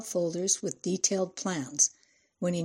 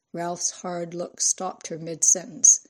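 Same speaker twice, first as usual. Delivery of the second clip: plain reading voice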